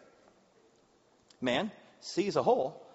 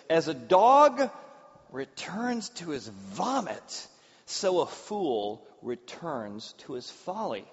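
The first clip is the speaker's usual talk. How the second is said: each word slow and weighty; slow proverb reading; 3 dB louder, much slower than usual. Shown as a demonstration. Preacher reads the proverb to the room, slow and weighty. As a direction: read the proverb slowly for weight. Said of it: "a proverb here that says".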